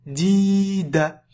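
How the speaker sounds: "Di, da" with long i, brief final a; long then short. Shown as a demonstration. Teacher showing the short ending, "Di" held and "da" brief. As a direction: stretch "Di" and leave "da" short. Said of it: "C'est court, hein".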